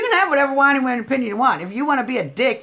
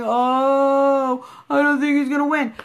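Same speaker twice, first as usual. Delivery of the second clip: a hint of a whiny doubter; lightly mocking a gloomy voter; mock whining; 3 semitones higher, slower than usual. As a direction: whine it like a defeatist fan. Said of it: "by whining and saying".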